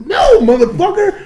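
loud, high indignant shout; outraged denial. Retold or imitated. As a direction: shout down the claim. Retold angry denial.

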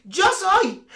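nervous actress pitching herself to a casting call; rushed casting self-intro. Pretend. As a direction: race through an audition intro against the clock. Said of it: "en veinte segundos decir".

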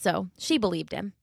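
plain reading voice

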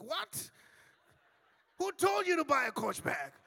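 shocked husband finding out about the bag; what, who told you to buy that; shocked outburst. Retold or imitated. Husband retells shocked reply.

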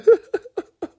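real giggling fit, pitched high; high helpless laugh. Real, not acted. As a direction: break into a high, uncontrollable laugh. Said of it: "about to cry laughing".

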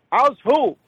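high, puzzled mock mother; hard-of-hearing mother. Mother who misheard her son's greeting, high confused voice. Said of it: "what she thinks you just said".

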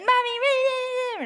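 put-on high, slow, whining kid voice; whiny child. Mother imitates her angry child whining for mom, drawn-out whine. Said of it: "empecé a usar con mis hijos".

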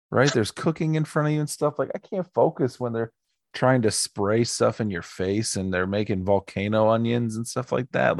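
ordinary delivery

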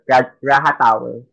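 "Raha Tower" slowly, with an H sound; J pronounced as H. Retold or imitated. Passenger giving cab driver the condo name, "Raha Tower" with H. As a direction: say "Raha Tower" with breathy H in place of J. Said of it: "you pronounce J as H".

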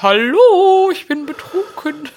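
high, slurred mock drunk. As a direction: play a tipsy party guest, high. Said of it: "hab ich gedacht".

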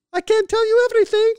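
shrieky-high, loud Hungarian lady voice; Hungarian mother. Retold or imitated. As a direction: mimic your Hungarian mom, very high and loud. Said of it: "she goes like this".

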